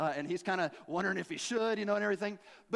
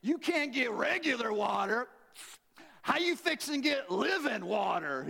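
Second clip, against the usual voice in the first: high, drawn-out sassy disbelief; incredulous challenge; 4 semitones higher than usual. Acted. Woman challenges Jesus about living water.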